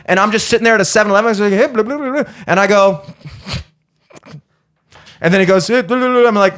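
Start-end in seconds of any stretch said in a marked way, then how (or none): 1.5-2.3 higher-pitched babbling imitation; mock gibberish. Customer mimicking a clerk he can't understand, mock gibberish. 5.7-6.3 higher-pitched babbling imitation; mock gibberish. Customer mimicking a clerk he can't understand, mock gibberish.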